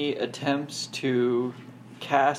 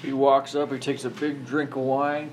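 ordinary delivery

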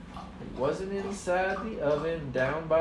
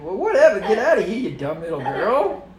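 louder, dismissive shooing; gruff brush-off; 5 semitones higher, 12 dB louder than usual. Acted. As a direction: wave her off loudly. Stoogie shooing the girl.

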